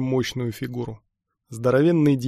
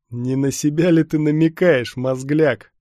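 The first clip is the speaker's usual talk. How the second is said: loud, raised challenge; scornful taunt; 3 semitones higher, 7 dB louder, slower than usual. A narrator's reading. Stormtrooper taunts the one who challenged him, scornfully.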